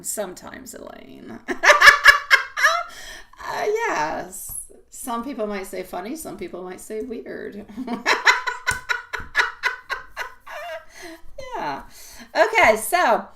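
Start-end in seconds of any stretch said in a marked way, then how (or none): 1.4-3.4 loud high burst of laughter; sudden high giggling fit. Real, not acted. Laughing at Elaine's remark. 7.7-11.5 high breathy giggle; amused self-conscious laugh. Real, not acted. Laughs after saying people call her weird.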